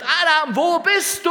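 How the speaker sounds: high, loud drawn-out call. Acted. Preacher voices God seeking Adam in paradise, calling out. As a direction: cry out as if searching for someone lost. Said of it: "und er ruft".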